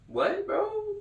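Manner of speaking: high, disbelieving squeak; shocked. Real, not acted. Reacting to the beehive story, squeaky disbelief.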